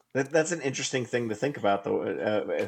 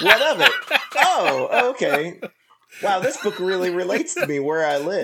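high, loud laughing defiance; 8 semitones higher, 7 dB louder than usual. Real, not acted. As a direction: giggle through a mock challenge.

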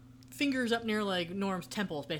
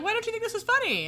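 goofy sitcom voice; 6 dB louder than usual. Retold or imitated. Norm back in character.

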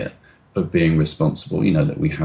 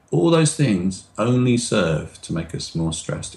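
ordinary talk, nothing marked

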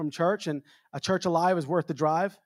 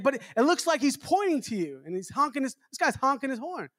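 wife pointing out; 7 semitones higher than usual. Retold or imitated. Wife voiced pointing out driver.

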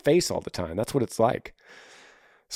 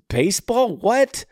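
incredulous; 9 semitones higher, slower than usual. Real, not acted. Disbelief about baseball.

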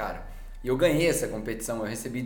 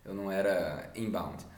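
ordinary talk, nothing marked

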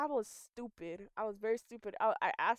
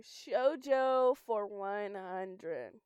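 slow; much slower than usual. Real, not acted. Reading the pick aloud slowly.

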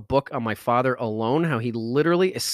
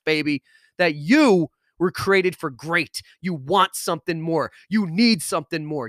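rousing pep talk; 4 semitones higher than usual. Acted. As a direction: fire them up and keep climbing to the peak. Motivational pep talk builds to climax.

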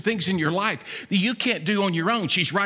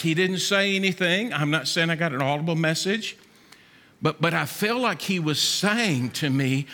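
ordinary talk

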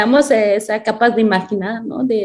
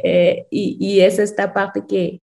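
ordinary talk

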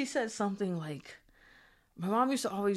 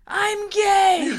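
much higher and louder shout; shouted confession; 12 semitones higher, 10 dB louder than usual. Retold or imitated. Daughter yelling at her scolding mother, shout. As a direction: yell it out. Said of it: "I was like".

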